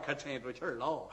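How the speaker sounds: usual speaking voice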